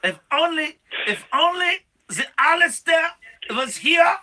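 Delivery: loud, high hammed-up Swiss shout; mock Swiss rallying cry. Host in a Swiss voice loudly calling for Alistair, mock rally shout. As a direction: call out for Alistair like a Swiss patriot. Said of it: "It's a Swiss accent".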